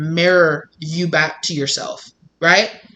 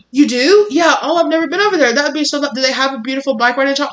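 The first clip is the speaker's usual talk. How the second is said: much higher, gushing mock delight; fake excitement; 7 semitones higher, faster than usual. Liar gushing about bike trails, hammed-up high excitement. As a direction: overplay the surprise, hammed-up.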